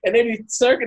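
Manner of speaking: ordinary talk, nothing marked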